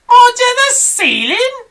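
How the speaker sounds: very high, slow, squeaky disbelief; small monkey can't believe it; astonished cry. Acted. Small monkey cries out at its dad, astonished. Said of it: "cried one of the small monkeys".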